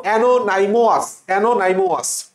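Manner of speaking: অ্যানোনাইমোয়াস chanted briskly as ano-nai-mo-as; spelling pronunciation. Shown as a demonstration. Teacher urging students to repeat, spelling pronunciation. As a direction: drill অ্যানোনাইমোয়াস with a clear "nai-mo-as". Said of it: "বলো, বলো, বলো".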